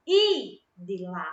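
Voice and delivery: Idila slowly, with the opening I held; stress on first I. Shown as a demonstration. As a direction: lean on the accented I of Idila. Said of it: "ele é acentuado".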